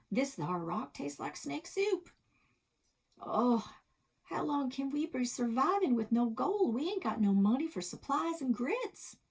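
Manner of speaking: high, twangy play-acting cowboy; a kid acting tough as a prospector; hammed-up cowboy kid. Kids playing cowboys, acted quote.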